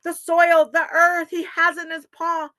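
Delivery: loud, high, triumphant announcement; cheering. Acted. Animals celebrate Muskrat bringing the dirt, cheering. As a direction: proclaim the great news to everyone. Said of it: "they all cheered".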